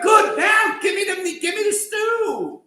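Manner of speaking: loud, high hammed-up yell; mock shout. Hungry Esav shouting at Yaakov for the stew, high yell. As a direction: Esav bellowing for his stew.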